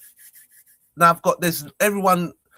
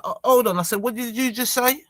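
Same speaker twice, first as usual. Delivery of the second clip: higher-pitched, disbelieving demand; retold indignant challenge; 7 semitones higher than usual. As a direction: challenge them on what they muttered. Challenges what the driver said.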